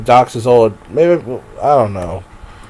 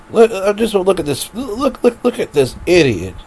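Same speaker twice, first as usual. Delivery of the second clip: mocking; 8 semitones higher than usual. Real, not acted. Mocking a man on screen.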